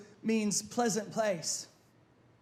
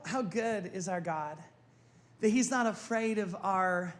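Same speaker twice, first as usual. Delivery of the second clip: ordinary talk, nothing marked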